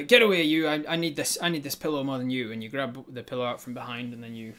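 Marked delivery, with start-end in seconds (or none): 0.0-2.5 louder, higher bark; loud shove-off. Acted. Tyrant shoving the gnome off. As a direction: push him off loudly.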